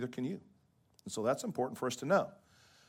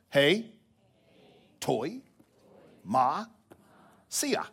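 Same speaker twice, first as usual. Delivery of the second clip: "hei... toi... ma... sia" with long pauses between; feed "hei, toi, ma, sia" out piece by piece for repeating; syllable by syllable; 2 semitones higher, 4 dB louder, much slower than usual. Shown as a demonstration. Preacher teaching the congregation Greek, syllable by syllable. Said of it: "I'll say it real slow".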